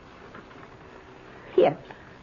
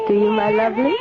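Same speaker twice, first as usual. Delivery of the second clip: ordinary talk, nothing marked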